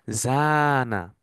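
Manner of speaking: জানা with the middle a stretched very long and slow; long alif drawn out. Shown as a demonstration. Teacher to students, stretching the long alif in জানা. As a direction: hold the long vowel in জানা. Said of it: "দেখেন এখানে একটা টান আছে".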